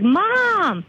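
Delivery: high-pitched imitation of an exasperated daughter; mock exasperated protest. Birthday girl to her mom about the cake, high mock protest.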